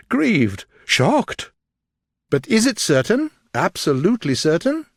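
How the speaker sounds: shocked gentleman. A narrator's reading. Darcy asking Elizabeth if it's certain, shocked. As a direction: press for certainty, shaken.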